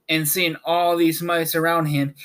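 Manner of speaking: plain reading voice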